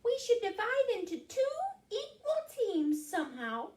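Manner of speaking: high little mouse voice; high-pitched storybook mouse; explaining mouse. Acted. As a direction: pipe up high as a small mouse. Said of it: "explained Mouse".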